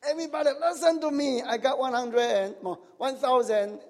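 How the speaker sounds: high, hurried announcement, easing down; mock boastful shout. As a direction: brag as if calling to everyone below. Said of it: "go up to the roof and shout out".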